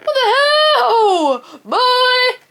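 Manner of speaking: high, loud, slow disbelieving yell with a laugh; half laugh, half shriek at the dog; shocked laughing outcry. Real, not acted. Scolding the dog over the pants, laughing shriek.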